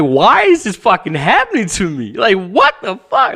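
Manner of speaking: loud, high, desperate outburst of panic; freaking out at a Latin King's stare; panicked disbelief. Retold or imitated. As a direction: cry out like the floor just dropped away. Terrified, suddenly in a gang's sights.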